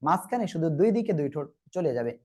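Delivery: usual speaking voice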